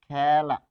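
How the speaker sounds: খেলা stretched out as "khææ-la"; e pronounced æ. Shown as a demonstration. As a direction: slowly model খেলা with a long open æ. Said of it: "এর উচ্চারণ".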